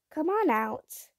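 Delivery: high, slow coaxing call; gentle coaxing. A narrator's reading. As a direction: coax it out like a shy pet. Coaxing the monster out.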